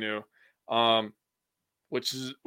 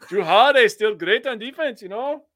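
high, louder put-on Canadian lilt through laughter; mock Canadian accent; 10 semitones higher, 5 dB louder than usual. Cohost laughing through the bit, mock Canadian accent. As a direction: giggle through a fake Canadian sing-song. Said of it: "That was annoying".

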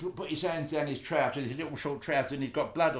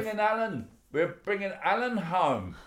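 excited; 8 semitones higher than usual. Retold or imitated. Mum announces Alan returning.

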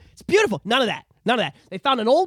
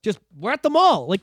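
normal talking voice

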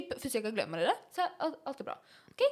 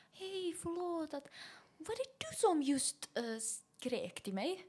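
timid apology; 6 semitones higher, 7 dB quieter, slower than usual. Retold or imitated. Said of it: "jag var så här".

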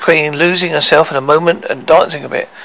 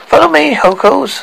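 queen voice; 9 semitones higher than usual. A narrator's reading. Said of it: "said the Queen".

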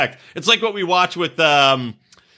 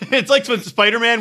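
normal talking voice

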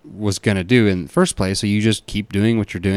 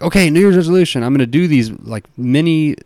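higher, pushed-up eager resolver; mock resolution zeal; 6 semitones higher than usual. Host imitating someone announcing unsustainable resolutions, with mock zeal. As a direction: put on keen New Year's determination. Mocking typical resolution maker.

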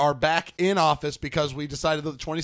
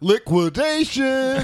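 higher, slow drawn-out announcer call; announcer shout; 8 semitones higher, 3 dB louder, much slower than usual. Pretend. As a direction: bellow it like a sale ad. Announcer shout about selling stuff.

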